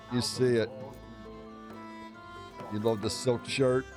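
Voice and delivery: ordinary delivery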